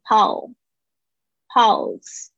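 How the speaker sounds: slow "pause" said as "pulse" with L; clear dark L. Shown as a demonstration. Teacher models the PUL sound, slow careful "pause" as "pulse". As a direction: stretch it, tongue tip up for L in "pause". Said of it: "你的舌尖有没有顶住前排牙齿后面".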